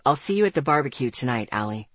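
low, quick male character voice; young man's voice. A narrator's reading. Boy saying goodbye until the barbecue, low male voice. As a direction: drop the voice and say it quickly, sinking at the end.

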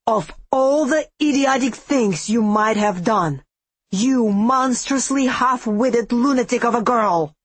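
furious, contemptuous snarl. Acted. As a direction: snap it out at the foolish girl. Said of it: "and snarled".

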